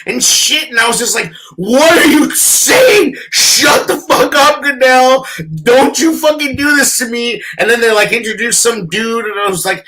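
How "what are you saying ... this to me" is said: retold exasperated yell. Fan telling Goodell to stop stalling, exasperated yell. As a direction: re-enact yelling at the TV in disbelief.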